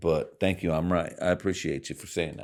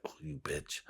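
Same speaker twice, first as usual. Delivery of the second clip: muttered mock insult; 4 semitones lower, 16 dB quieter than usual. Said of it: "And I was like".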